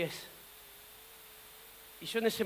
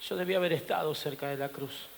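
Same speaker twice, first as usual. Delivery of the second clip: softly lowered, regretful refrain; quiet regret; 6 semitones lower, 7 dB quieter than usual. Acted. Peter looks back on the cross, quietly regretful. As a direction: say it low, with a touch of regret.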